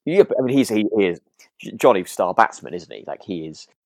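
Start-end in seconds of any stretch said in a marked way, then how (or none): none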